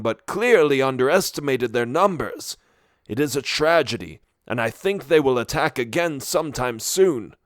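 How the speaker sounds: put-on high old wizard voice, weary; a kindly wise wizard, regretful. A narrator's reading. Barloth continues speaking.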